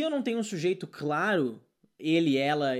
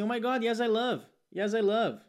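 lightly higher, eager learner gushing; mock gushing fan; 5 semitones higher, slower than usual. Student gushes about [name], mock excited. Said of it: "a pessoa fala assim".

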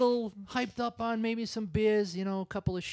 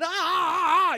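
mock scream; 11 semitones higher, 5 dB louder, slower than usual. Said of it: "the other cop's screaming".

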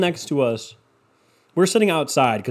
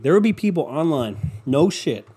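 ordinary talk, nothing marked

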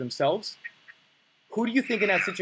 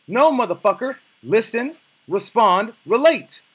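much higher and louder, each word punched out; fired-up shout; 7 semitones higher, 9 dB louder, slower than usual. Real, not acted. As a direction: bark the three rules like a drill coach. Coach hammers home his rule.

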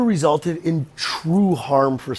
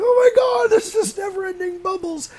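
shrieking, much higher surprised shout; mock amazement. Someone discovering endless bubbles, high shrieking surprise. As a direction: squeal it in sudden wonder.

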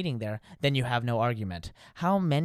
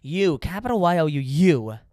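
"You" spelled out loud and punched; stress on you; 3 semitones higher, 6 dB louder than usual. Real, not acted. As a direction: hammer the word "you" louder. Emphatic you.

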